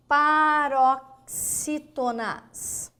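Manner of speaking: paroxítonas drawn out very slowly; stretched out. Shown as a demonstration. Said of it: "slow dictation". (paroxítonas bem devagar)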